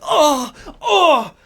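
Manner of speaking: loud, high fake yelp of pain; hammed-up pain cry. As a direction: overact being hit in the groin. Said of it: "I just completely hammed it up".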